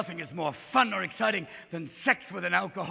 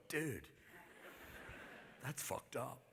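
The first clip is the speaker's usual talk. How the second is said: low, hushed disbelief; appalled devil; 6 semitones lower, 17 dB quieter, slower than usual. Acted. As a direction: say it softly as a shocked devil. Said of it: "even the devil would go".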